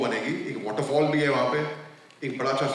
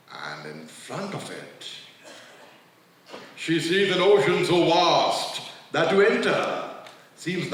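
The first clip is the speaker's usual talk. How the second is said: poem recitation. Acted. Recites Gibran's poem about fear.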